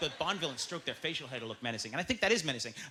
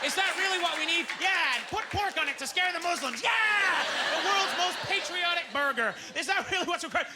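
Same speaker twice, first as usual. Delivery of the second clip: patriotic hype; 11 semitones higher, 3 dB louder than usual. Acted. Hyped executives shout ideas.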